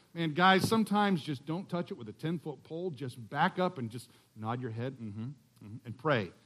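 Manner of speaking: ordinary talk, nothing marked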